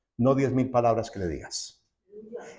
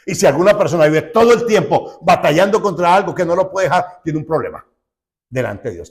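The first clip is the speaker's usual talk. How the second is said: louder, higher sermon warning; preaching at full force; 5 semitones higher, 8 dB louder than usual. Acted. Preacher warning believers stuck in endless struggle, louder and higher. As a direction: press the point home from the pulpit.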